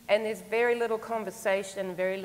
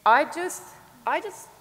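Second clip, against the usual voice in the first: real emotion catching in the voice; welling up mid-sentence, a higher, louder break; real feeling breaking through; choked up; 7 semitones higher, 7 dB louder than usual. Real, not acted. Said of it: "now I'm emotional about it".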